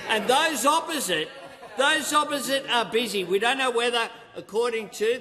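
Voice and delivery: loud, high-pitched jab at opponents; shouting. Real, not acted. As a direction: raise your voice to attack. Heated attack on opposition.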